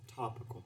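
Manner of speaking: "topical" breathed out, laughing; stressed topical. Acted. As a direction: lean on "topical" with a scoffing breath. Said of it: "and I emphasize this".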